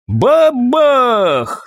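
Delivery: high, drawn-out bang word; explosive boom. A narrator's reading. Narrator voices the salvo hitting, an explosive high cry. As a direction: boom it out like a cannon going off.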